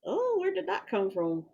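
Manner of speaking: high, soft, smiling wonder; smiling feigned innocence. Acted. Corey looks at Georgie after the curse, smiling innocence. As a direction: ask it softly through a smile. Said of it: "smiles, like".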